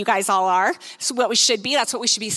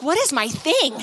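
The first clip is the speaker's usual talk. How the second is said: high, puzzled protest; mock bafflement; 7 semitones higher than usual. Speaker reacts to client calling it her thing, mock bafflement.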